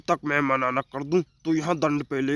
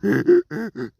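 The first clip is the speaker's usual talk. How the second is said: loud, proud belly laugh; gloating laugh; 5 semitones higher, 5 dB louder than usual. Acted. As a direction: laugh big at your own glory. Donkey gloats over praise.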